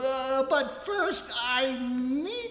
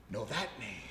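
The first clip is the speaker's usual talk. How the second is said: much lower and softer, caught out; low shaken murmur; 13 semitones lower, 13 dB quieter, much faster than usual. Acted. Suitor hearing Annette's name, lowering his voice in alarm. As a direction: let it drop away, rattled.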